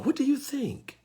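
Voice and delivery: asking gently, lightly voiced high; mature person asking. Preacher voicing a mature adult asking the listener, lightly high. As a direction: offer the question, a hint of an open listener. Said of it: "an adult person can say is".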